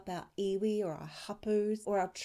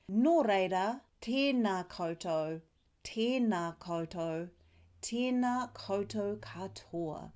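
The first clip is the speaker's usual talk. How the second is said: formal closing. Shown as a demonstration. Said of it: "To finish off your pepeha, say".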